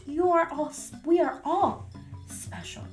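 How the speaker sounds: ordinary delivery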